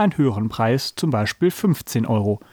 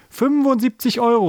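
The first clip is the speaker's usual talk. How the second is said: high, excited cry; enthusiastic shout; 10 semitones higher than usual. A narrator's reading. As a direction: blurt it out far too eagerly. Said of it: "rief Paul begeistert. Allerdings viel zu laut".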